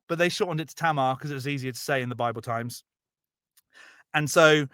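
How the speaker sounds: ordinary talk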